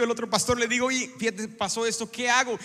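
ordinary delivery

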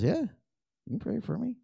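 high, slow, softened voice of a humble friend; soft high reply. Retold or imitated. Dave in the bleachers accepting prayer, soft high and slow. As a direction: let him quietly accept.

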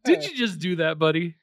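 high, loud laughing accusation; mock exasperated. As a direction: call him out, laughing. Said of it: "And I was like".

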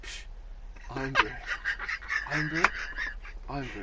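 hushed Irn-Bru hawking; whispered dealer pitch. Retold or imitated. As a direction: sell it like contraband on a corner. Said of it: "Just whispering it at people".

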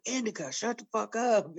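scolding the dog. Retold or imitated. Owner telling her dog Indica to hush. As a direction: tell the dog off mid-call.

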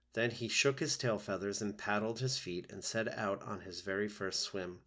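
ordinary delivery

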